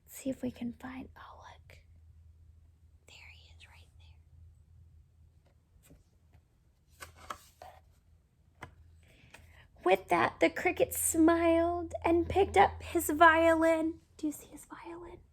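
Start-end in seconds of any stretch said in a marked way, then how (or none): 0.0-8.7 very soft, fast hush; whisper. Real, not acted. Searching the picture with the child, whisper. 14.2-15.2 quick, much softer hush; whisper. Real, not acted. Asking the child about the violin, fast whisper.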